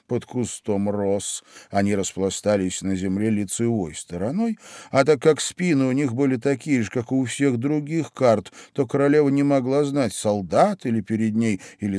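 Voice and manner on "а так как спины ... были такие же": plain reading voice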